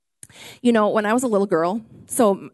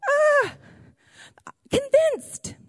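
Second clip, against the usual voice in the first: put-on high gasp of a frightened child; mock scared gasp; much slower than usual. Little girl frightened when the light goes out, high mock gasp. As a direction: gasp as if the dark just swallowed you.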